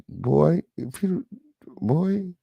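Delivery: high exasperated mock scold; voicing Lisa's fed-up look; imitating Lisa. Host voicing Lisa, fed up with the preacher, mock scold. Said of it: "Lisa looked like".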